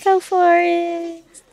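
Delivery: high, slow encouraging cheer. Real, not acted. Cheering baby's first food.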